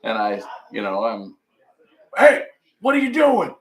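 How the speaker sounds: ordinary talk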